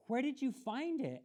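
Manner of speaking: high eager question; breathless excitement. A narrator's reading. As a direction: ask in a breathless rush. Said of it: "asked Yoonhae breathlessly".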